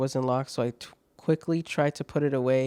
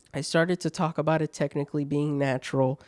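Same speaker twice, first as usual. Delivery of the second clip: normal talking voice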